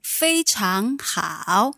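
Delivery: 非常好 said slowly with clear Mandarin tones; slow model. Shown as a demonstration. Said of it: "help me work out these tones".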